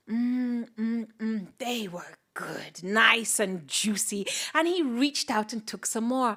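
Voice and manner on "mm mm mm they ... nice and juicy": savouring whisper. Acted. Fire tastes grass.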